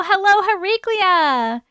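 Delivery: high drawn-out hello; sing-song greeting. Acted.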